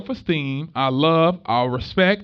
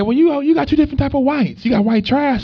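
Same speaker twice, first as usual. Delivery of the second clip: quoting her; 10 semitones higher, 4 dB louder than usual. Retold or imitated. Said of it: "She said".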